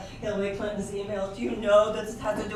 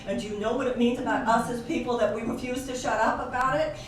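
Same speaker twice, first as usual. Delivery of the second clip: ordinary talk, nothing marked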